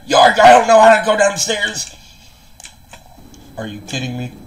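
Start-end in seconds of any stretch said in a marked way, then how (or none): 0.1-1.9 high, loud pirate holler; pirate shouting high and loud; loud pirate call. Acted. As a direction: call out for help like a lost pirate. Pirate character calls out lost.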